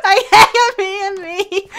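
can't keep a straight face; laughing mock vow.

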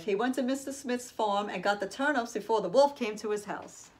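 plain reading voice

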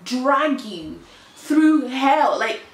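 high and loud, dragged out slowly; frustrated rant. Real, not acted. Telling viewers about the call to bar process, a high, loud, fed-up rant. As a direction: let the frustration spill over.

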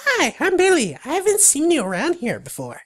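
very high, squeaky critter voice; friendly little creature. Acted. Little creature greeting a newcomer, high squeaky voice. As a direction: pipe up in a high cartoon voice. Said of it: "The little creature waves you and says".

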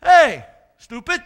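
loud, slow rebuke in a donkey voice; talking donkey. Acted. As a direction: snap at the master as the donkey. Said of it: "Turned around and talked to him".

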